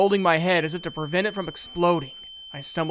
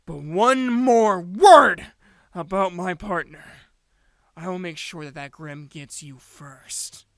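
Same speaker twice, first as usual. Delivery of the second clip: slow cold threat; 3 semitones higher, 6 dB louder, slower than usual. Acted.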